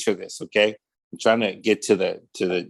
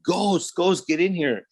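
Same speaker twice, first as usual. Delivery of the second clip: mock-call a friend in, pitched up; calling for help; 7 semitones higher than usual. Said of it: "I start calling for my homeboy".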